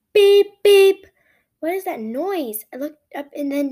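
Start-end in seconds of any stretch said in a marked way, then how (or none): 0.1-1.1 high, very loud digital beep; the alarm growing louder. A narrator's reading. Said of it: "It's getting louder".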